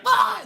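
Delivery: high loud scream; mock scream. Guard getting shot in the head, a high yell.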